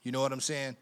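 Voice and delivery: normal talking voice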